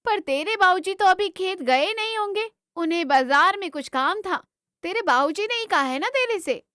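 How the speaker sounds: high, questioning mother's voice; doubtful village mother. Acted. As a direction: query your son's message with suspicion. Mother questions her son.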